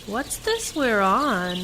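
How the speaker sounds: loud and slow over the wind. Acted. Passenger on a tandem ride calls over the wind, loud. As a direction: call out over the rushing air. Riding in open car, wind.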